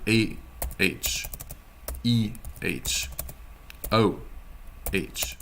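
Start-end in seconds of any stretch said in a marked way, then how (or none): none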